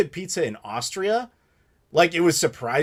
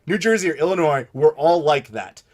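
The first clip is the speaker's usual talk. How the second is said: ordinary delivery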